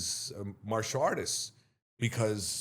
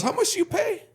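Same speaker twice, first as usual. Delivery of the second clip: very high, loud mocking question; scoffing brother; 5 dB louder than usual. Brother asks about fees, high scoffing voice.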